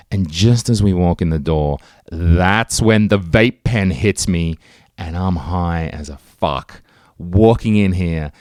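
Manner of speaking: ordinary talk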